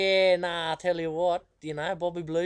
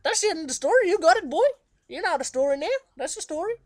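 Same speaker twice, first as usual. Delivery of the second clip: much higher, louder granny voice; Nana's answer; 13 semitones higher, 9 dB louder than usual. Retold or imitated. Nana tells Bobby the story is finished, high and cheeky. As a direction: round it off firmly like a cheeky nana. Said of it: "And she'd say".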